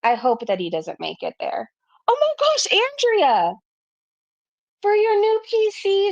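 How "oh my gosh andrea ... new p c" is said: high, delighted cry of a name; excited squeal. Real, not acted. Thanking Andrea for her super chat, a high excited squeal. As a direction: burst out in real delight at the gift.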